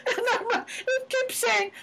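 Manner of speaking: laughing. Real, not acted. Laughing while retelling GPS story.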